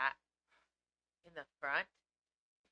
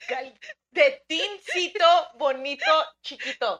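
slow, much higher and louder cooing, drawing out each word; 9 semitones higher, 15 dB louder, much slower than usual. Real, not acted. Owner cooing at her little cat Calcetín, high baby talk.

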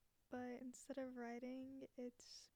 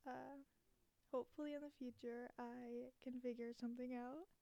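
usual speaking voice

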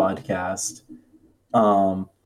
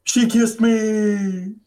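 put-on high, slow proud kid voice; little boy announcing his triumph; 11 semitones higher, much slower than usual. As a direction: savour it like a boy bragging. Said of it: "And he's like".

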